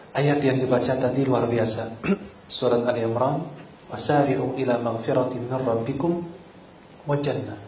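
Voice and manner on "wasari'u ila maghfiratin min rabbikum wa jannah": softer, brisk reading of the verse; just quote the verse, don't chant it; quiet quick verse quote; quick spoken recitation. Shown as a demonstration. Quick quoting of Quran verse.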